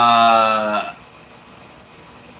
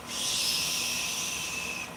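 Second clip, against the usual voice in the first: shushing; 14 dB quieter than usual. Real, not acted. Hushing the noisy phone.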